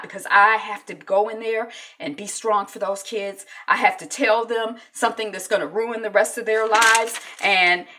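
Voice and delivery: forceful insistence. Retold or imitated. Wife telling her friend to be strong for the kids, forcefully. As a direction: lay down the law with urgent force.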